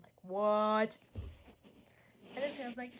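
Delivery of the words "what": mildly acted shock; a small, disbelieving exclamation. Repeating the drawn character's reaction, mildly shocked. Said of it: "He's very shocked".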